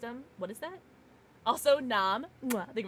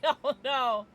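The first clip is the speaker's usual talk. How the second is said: normal talking voice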